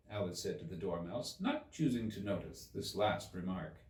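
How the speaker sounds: ordinary delivery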